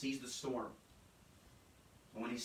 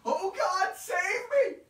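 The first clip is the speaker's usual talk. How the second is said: desperate cry for rescue, much higher and louder; frantic cry; 15 dB louder, much slower than usual. Acted. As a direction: scream to God in panic. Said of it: "it is a frantic".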